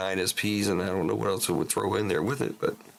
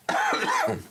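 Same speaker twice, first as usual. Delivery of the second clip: sudden sharp cough; 4 dB louder than usual. Real, not acted. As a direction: cough hard mid-sentence. Coughs while talking to viewers.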